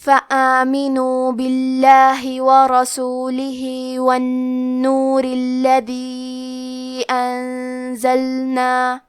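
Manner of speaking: slow, drawn-out chanted tilawat; Quran recitation. Acted. Teacher recites the verses in tajweed recitation style.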